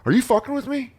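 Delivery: high incredulous demand; exasperated disbelief. Retold or imitated. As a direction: ask it like they're messing with you. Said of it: "and I'd be like".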